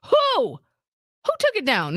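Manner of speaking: high, startled questioning; incredulous demand. Retold or imitated. Daughter asks mom who took things down, incredulous. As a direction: reenact disbelief, first word shooting up high.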